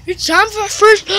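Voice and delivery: ordinary delivery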